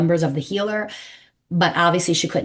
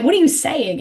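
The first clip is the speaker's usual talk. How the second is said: incredulous teasing; 9 semitones higher than usual. Retold or imitated. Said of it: "you know, like".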